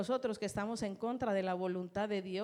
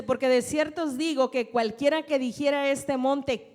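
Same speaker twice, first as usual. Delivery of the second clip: loud, slow preaching of the verse; preaching scripture; 3 semitones higher, 8 dB louder than usual. Acted. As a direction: proclaim each word to the congregation. Preacher reading scripture aloud.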